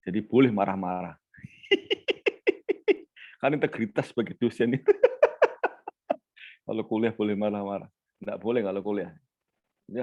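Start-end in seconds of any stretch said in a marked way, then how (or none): 1.7-3.0 high laugh. Real, not acted. Lecturer laughs after joking. 4.9-6.1 breathy laugh. Real, not acted. Lecturer laughs after joking.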